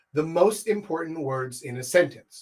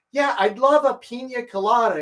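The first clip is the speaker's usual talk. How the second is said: put-on girly voice; 7 semitones higher, 3 dB louder than usual. Girl's voice in a retold scene.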